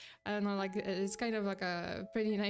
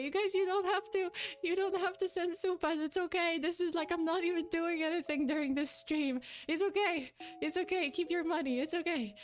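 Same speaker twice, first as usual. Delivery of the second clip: high-pitched, insistent reassurance; earnest protest; 8 semitones higher than usual. Real, not acted. Streamer telling viewers not to send money, earnest protest. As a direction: gently wave the gift away, voice lifted high.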